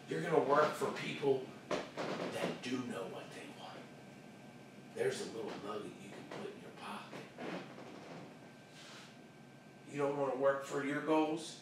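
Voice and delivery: very soft, low confiding voice; hushed warning. Real, not acted. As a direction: drop right down to share the lesson. Drops voice to deliver the lesson.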